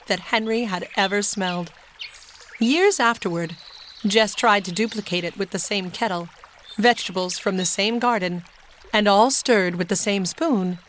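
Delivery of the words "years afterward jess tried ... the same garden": usual speaking voice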